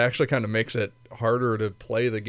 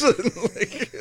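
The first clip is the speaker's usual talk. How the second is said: laughing; 12 semitones higher than usual. Real, not acted. Laughing after quote.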